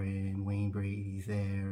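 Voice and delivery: usual speaking voice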